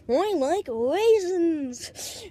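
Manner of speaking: high, very slow mock French-British; French-British accent. Showing the accent he wished he had, high French-British drawl. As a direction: stretch each word in a put-on French-British accent. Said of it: "in some French kind of British accent".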